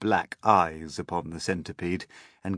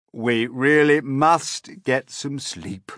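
put-on old grasshopper; 7 semitones higher, 8 dB louder, slower than usual. A narrator's reading. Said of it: "the old green grasshopper said".

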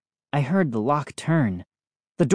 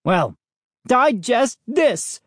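high, slow angry yell; screamed; 8 semitones higher, slower than usual. A narrator's reading. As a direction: yell it as a furious retort. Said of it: "Dad screamed".

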